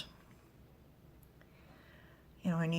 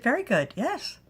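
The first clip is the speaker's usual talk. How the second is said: high, loud creature voice; high excited critter voice; 8 semitones higher, 7 dB louder than usual. Acted. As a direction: chirp it out like an excited little creature. Creature figure praising.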